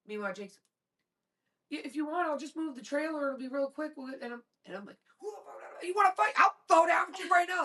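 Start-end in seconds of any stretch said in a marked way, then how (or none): 1.7-3.9 put-on higher-pitched, mild young man's offer; placating son. Polite son placating the man. 5.2-7.6 high-pitched combative taunt; mock street-fight challenge.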